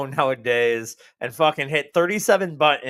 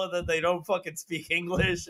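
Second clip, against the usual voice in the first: normal talking voice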